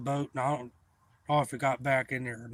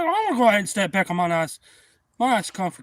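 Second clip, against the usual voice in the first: retold indignant retort; 7 semitones higher, 7 dB louder than usual. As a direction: act out huffing off, pitched up and loud. Storyteller's indignant retort in quarrel.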